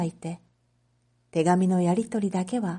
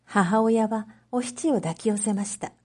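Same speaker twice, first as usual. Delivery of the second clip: usual speaking voice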